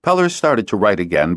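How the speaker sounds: plain reading voice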